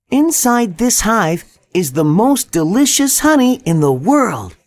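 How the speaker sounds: put-on a young boy's voice, tempting; clever boy voice.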